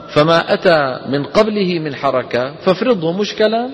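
slow measured chanting of the poem; verse recitation. Shown as a demonstration. Teacher reciting a tajweed poem to students, slow chant. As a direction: recite the verse slowly and evenly.

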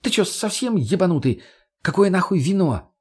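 high-pitched, outraged questioning; affronted woman's high retort; indignant. A narrator's reading. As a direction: demand it in scandalised disbelief. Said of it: "от возмущения".

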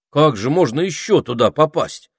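lively amazed query; surprised. A narrator's reading. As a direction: ask it in open astonishment. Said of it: "удивился волшебник".

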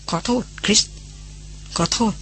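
a beaten man's quiet, shaky sorry; trembling voice. A narrator's reading. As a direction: say sorry with a voice about to break. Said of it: "พูดเสียงเครือ".

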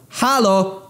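high hail to a shadowy figure; calling out. Acted. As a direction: shout it across the cave. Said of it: "wykrzykując przy okazji w jego kierunku".

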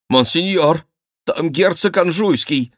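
high-pitched, alarmed announcement; frightened servant. A narrator's reading. Servant announces the duke to his master, scared and high-pitched. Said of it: "Вбежал насмерть перепуганный слуга".

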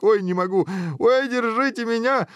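very high, gleeful acted cackling cries; helpless hilarity. A narrator's reading. Guard overcome by the prince's tale, helpless hilarity. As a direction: cry out as if dying of laughter. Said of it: "захохотал стражник".

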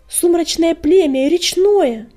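slow, loud, high gasping cry; breathless shout. A narrator's reading. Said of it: "задыхаясь, крикнул он".

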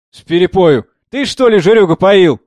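loud, high-pitched jeer; mocking mimicry. A narrator's reading. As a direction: mimic his words back with scorn. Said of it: "передразнивала певица". (громко передразнивая)

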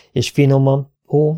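plain reading voice

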